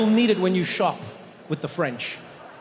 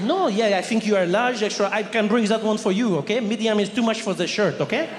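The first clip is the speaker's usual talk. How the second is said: French clerk; 3 semitones higher than usual. Retold or imitated. French clerk voiced in story.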